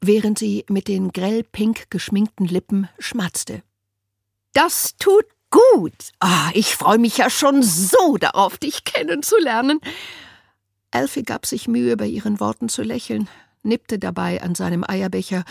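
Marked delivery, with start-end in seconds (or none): 4.5-9.8 overbearing social worker, delighted; Winnie. A narrator's reading. Winnie's voice held.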